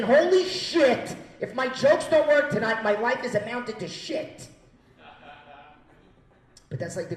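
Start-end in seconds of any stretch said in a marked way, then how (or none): 0.0-1.2 high, loud, drawn-out exclamation; panicked outburst. Acted. Comic facing the stage before his set, loud panicked exclamation. 1.4-4.5 high, loud despair, easing at the end; panicked outburst. Acted. Comic dreads his jokes failing tonight, loud panicked despair.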